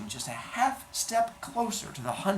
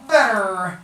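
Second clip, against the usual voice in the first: hunchback dwarf voice; 5 semitones higher, 9 dB louder than usual. Acted. Vizzini sneers at the man.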